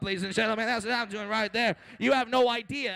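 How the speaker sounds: usual speaking voice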